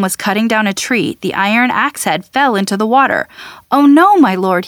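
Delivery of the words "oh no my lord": higher, louder cry of dismay. A narrator's reading. Man to Elisha over the lost axe head, dismayed cry. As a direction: cry out with a drawn-up pitch. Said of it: "he cried".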